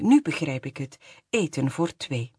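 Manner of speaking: ordinary delivery, nothing marked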